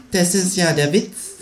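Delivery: high, slow smug kangaroo voice; Känguru voice. Acted. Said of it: "sagt das Känguru".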